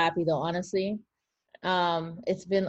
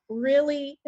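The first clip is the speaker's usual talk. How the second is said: high-pitched mock-sceptical query; raised, questioning disbelief; doubtful question; 6 semitones higher than usual. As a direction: imitate someone who doesn't buy it. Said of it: "they're like".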